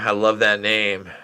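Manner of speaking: ordinary talk, nothing marked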